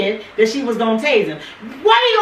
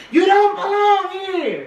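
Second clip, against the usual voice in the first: mock shouted confrontation; 7 semitones higher, slower than usual. Mocking the neighbor who told him he didn't belong, in a mock shout. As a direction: throw her words back at her in a mock yell.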